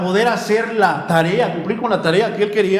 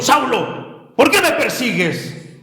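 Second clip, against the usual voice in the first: authoritarian; 5 semitones higher, slower than usual. Shown as a demonstration. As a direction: call him out sternly, like an authority. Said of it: "Más que sonar como autoritario". (tono autoritario, voz alzada)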